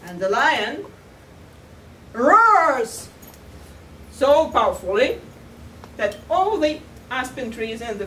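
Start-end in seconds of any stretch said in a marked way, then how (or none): 2.1-5.2 storyteller mimicking a lion's roar; roaring emphasis. A narrator's reading. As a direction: let the word itself roar out.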